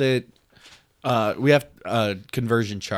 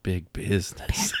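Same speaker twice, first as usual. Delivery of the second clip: whisper; 4 semitones lower, 4 dB quieter than usual. Pretend. Mock ad whisper.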